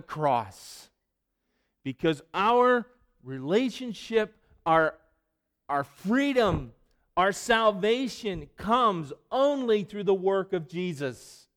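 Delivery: loud, high, slow preaching with weight; full-force preaching. Acted. Pastor pressing Paul's warning on the congregation, loud and slow.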